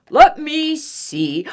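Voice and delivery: pondering bear. Acted. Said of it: "said the bear".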